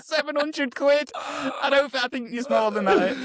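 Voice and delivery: high, amused disbelief; incredulous. Real, not acted. Doubting the price claim.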